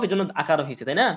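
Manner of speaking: usual speaking voice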